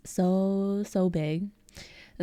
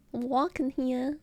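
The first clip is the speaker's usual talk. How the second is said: higher, hammed-up New Yorker; New York accent; 4 semitones higher, slower than usual. American streamer imitating New Yorkers for viewers, hammed-up New York accent. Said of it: "New Yorkers".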